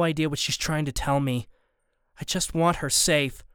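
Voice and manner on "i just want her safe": usual speaking voice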